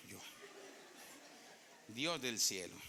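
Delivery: ordinary talk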